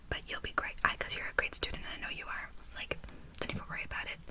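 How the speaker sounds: hushed, soothing ASMR whisper; reassuring whisper. Acted. Tutor reassuring a great student, whispered. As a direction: whisper encouragement to calm them.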